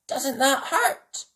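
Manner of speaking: high, slow puppy voice; curious puppy asking; Spot's voice. A narrator's reading. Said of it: "he asked".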